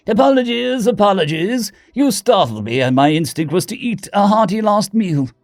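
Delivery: high-pitched upper-class British voice; posh British accent. A narrator's reading. Startled hamster diplomat apologises to Billy Bob, posh British accent. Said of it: "The posh upper-class British accent".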